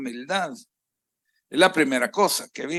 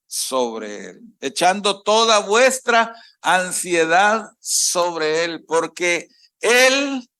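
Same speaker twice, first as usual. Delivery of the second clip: slow scripture reading; 2 semitones higher, slower than usual. Acted. Rereading the verse slowly.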